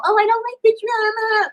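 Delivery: high mock-innocent voice; mocking high quote. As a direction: mimic her fake innocence. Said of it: "what really made me mad".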